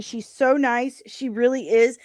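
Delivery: ordinary delivery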